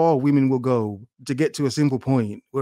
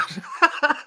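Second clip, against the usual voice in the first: higher, breathy giggle burst; 13 semitones higher than usual. Real, not acted. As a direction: break into a high giggle. Laughing at remark.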